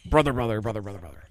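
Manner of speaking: mock wrestler chanting his one word; Hulk Hogan catchphrase. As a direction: repeat a wrestler's catchphrase in falling bursts. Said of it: "classic Hulk lines".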